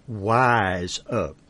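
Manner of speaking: "wise" said with a w instead of r; says w for r. Retold or imitated. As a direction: say "wise up" like a little boy's w for r. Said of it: "couldn't pronounce his R's".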